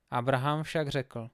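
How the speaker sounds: usual speaking voice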